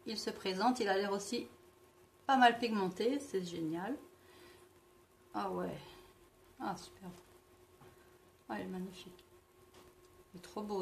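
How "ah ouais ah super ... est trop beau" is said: soft delighted murmur. Real, not acted. Delighted by the swatch.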